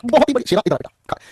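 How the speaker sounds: ordinary delivery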